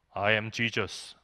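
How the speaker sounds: very slow, low, solemn declaration; psychiatric patient as Jesus. Retold or imitated. Patient in psych ward tells student he is Jesus, slow and low. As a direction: drag out each word like a self-declared messiah.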